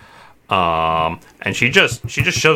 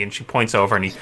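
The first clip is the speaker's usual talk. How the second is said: normal talking voice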